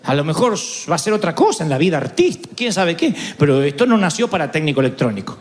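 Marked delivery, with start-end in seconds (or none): none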